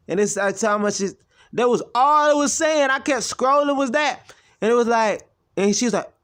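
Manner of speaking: excited storytelling. Real, not acted. Excited telling of call log.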